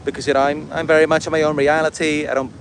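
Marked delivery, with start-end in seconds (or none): none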